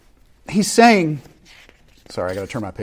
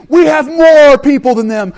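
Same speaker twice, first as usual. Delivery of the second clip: rallying shout; 11 semitones higher, 10 dB louder than usual. Retold or imitated. Preacher voicing a warlord rallying troops, big shout. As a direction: rouse the army like a warlord.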